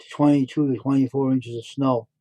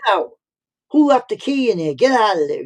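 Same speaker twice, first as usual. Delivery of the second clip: retold angry yell; 7 semitones higher, 5 dB louder than usual. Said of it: "he says".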